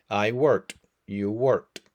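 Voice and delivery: ordinary delivery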